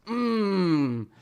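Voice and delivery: long hammed-up "mmm" of gratification; drawn-out mock moan of delight; mock pleasure moan. Mimics conservatives moaning with pleasure.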